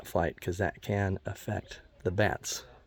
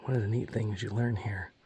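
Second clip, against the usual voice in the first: normal talking voice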